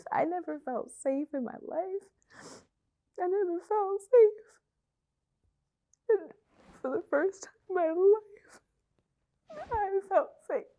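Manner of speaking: real breaking cry on felt safe. Real, not acted. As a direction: let the voice crack with emotion. Emotional climax of testimony.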